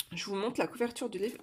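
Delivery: ordinary talk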